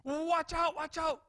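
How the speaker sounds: high-pitched urgent cry; alarmed parent's warning. Acted. Parent seeing danger warns a child, emphatic warning. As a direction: call out a sudden danger in a high voice. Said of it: "a parent sees the danger and they make an emphatic imperative".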